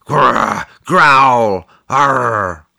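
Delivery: read-aloud imitation of a growling bear; drawn-out mock animal growls. A narrator's reading. Recorded bear growls imitated by narrator.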